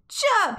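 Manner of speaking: very high bird chirp; silly chirp. Acted. Friday chirping just because, very high. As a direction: chirp for fun. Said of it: "he added".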